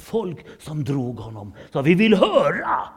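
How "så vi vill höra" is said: much higher and louder call; the crowd demanding to hear Paulus; eager crowd shout. Acted. (folket ropar högt att de vill höra)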